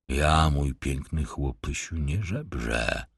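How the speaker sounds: low, soft mocking reply leaning on "nie" in "nie żebrze"; ironic and threatening. Tramp answering the stableboy with ironic menace, low and soft. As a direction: smile the threat, quietly. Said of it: "uśmiechając się z ironią i z taką zarazem groźbą".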